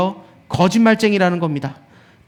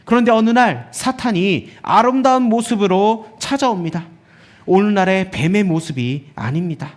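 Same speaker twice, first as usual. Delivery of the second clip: ordinary talk, nothing marked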